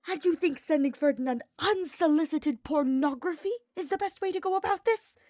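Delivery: high, loud, outraged screech. Acted. Hubert screeching at Sylvain in a high, outraged voice. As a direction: shriek it in disbelief. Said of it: "Hubert's screeching reached a new level of shrillness".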